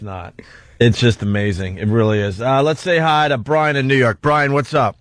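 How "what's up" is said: usual speaking voice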